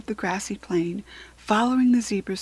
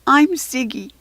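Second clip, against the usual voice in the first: higher, louder little foal; breathless little zebra; 5 semitones higher, 7 dB louder than usual. A narrator's reading. Little zebra introducing himself, breathless. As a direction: pant out your name. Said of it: "in a breathless voice".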